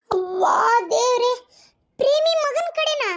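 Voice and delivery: slow, drawn-out cry climbing high; horrified disbelief. Acted. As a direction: stretch the words out, aghast. Mother horrified at who fetched it. (ಗಾಬರಿಯಿಂದ ಎಳೆದು ಕೂಗುವುದು)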